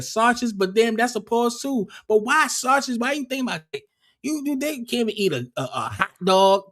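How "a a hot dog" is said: ordinary talk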